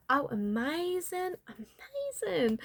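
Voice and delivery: gushing delight. Pretend. Gushing over client's booking.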